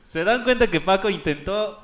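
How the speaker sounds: ordinary talk, nothing marked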